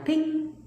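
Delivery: high-pitched little "ping"; imitated text alert. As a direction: mimic a message chime, high.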